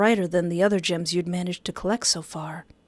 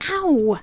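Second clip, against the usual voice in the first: high-pitched "ow"; pained yelp; 8 semitones higher, 4 dB louder than usual. Acted. Quill gets hurt, high pained yelp.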